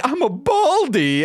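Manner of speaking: high, silly cartoon delivery; mock show voice. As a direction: say it like a goofy cartoon character. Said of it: "how they are saying it, they're like".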